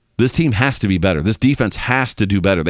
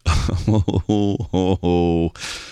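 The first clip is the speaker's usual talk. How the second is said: rueful laugh; 3 semitones lower than usual. Real, not acted. Laughs at Bills defense.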